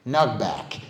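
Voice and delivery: usual speaking voice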